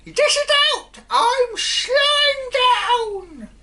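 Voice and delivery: put-on shrieking old hag's whoop, very high and loud; cackling witch-like cry of glee; old hag shrieking with delight; screeching shout. A narrator's reading. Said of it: "she yelled".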